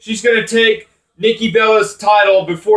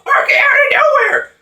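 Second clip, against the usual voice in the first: much higher shouted announcer cry; Michael Cole call. Retold or imitated. Mocking the commentator's catchphrase, shouted very high. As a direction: belt it out like a hyped ringside commentator. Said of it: "and Michael Cole".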